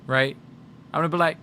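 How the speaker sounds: normal talking voice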